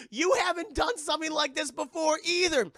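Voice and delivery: high, loud retort as the mom; indignant mother. Retold or imitated. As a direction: argue back loudly in a high voice. Mother argues back.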